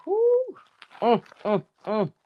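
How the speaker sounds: ordinary talk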